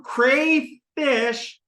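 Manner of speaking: "crayfish" slowly, with a long AY; drawn-out crayfish. Shown as a demonstration. Teacher feeling where the sounds sit, slow and deliberate. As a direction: stretch both syllables of "crayfish". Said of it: "all in the same spot in my mouth".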